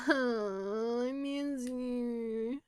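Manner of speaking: theatrical weepy wail; mock sob. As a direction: whimper it like a hammed-up heartbreak. Mock lament for absent wife.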